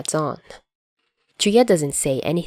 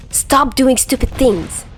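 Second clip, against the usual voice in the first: higher, slow, exasperated snap; snapping; 8 semitones higher, 3 dB louder, slower than usual. A narrator's reading. Chuya snaps at Dazai after the near miss, higher slow. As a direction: snap it at your reckless partner. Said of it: "Chuya snaps back".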